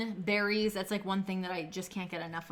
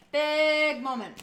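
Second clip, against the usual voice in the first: slow, loud, dramatic build-up; big dramatic announcement; 7 semitones higher, 9 dB louder, slower than usual. Real, not acted. Cooking for viewers, announcing the big moment dramatically. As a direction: drag it out like a big reveal.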